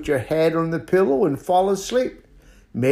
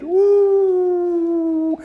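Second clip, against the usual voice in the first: high, sustained owl hoot; long high "whoo"; 4 dB louder than usual. Acted. Owl hoots in the story.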